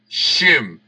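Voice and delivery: "Shim" with a clear sh; sh sound. Shown as a demonstration. Preacher teaching the Hebrew letter, "Shim" with sh. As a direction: repeat "Shim", sh at the start. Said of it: "se pronuncia sh".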